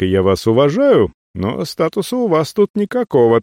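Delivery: ordinary delivery, nothing marked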